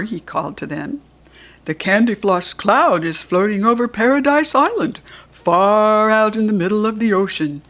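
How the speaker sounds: seahorse voice. Acted. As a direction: call up from the waves like a kindly old seahorse.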